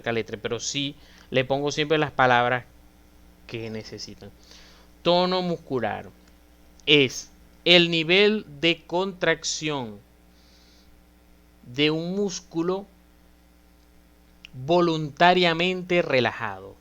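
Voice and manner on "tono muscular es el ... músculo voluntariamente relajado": deliberate, with long pauses between phrases; measured teacher's dictation, phrase by phrase; slow dictated definition. Shown as a demonstration. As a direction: give it out chunk by chunk for students to learn.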